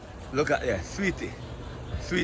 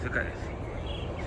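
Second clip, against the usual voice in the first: awed whisper; 10 dB quieter, much slower than usual. Real, not acted. Awed at the mango tree.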